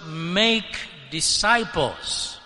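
"make disciples" drawn out slowly, each word weighted; slow right down and lean on "make disciples"; stress on "make disciples". Shown as a demonstration. Preacher correcting where emphasis must be placed, stressing "make disciples". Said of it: "more important emphasis must be placed".

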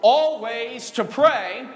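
Always drawn out loud and slow; stress on ALWAYS. Real, not acted. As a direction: lean hard on the word Always. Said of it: "what's that word, church?".

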